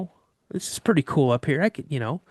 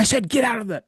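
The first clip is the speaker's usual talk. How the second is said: higher-pitched, insistent command; retold angry order; 8 semitones higher than usual. Dad ordering son down from the tree, retold angry insistence. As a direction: repeat the order urgently, imitating dad.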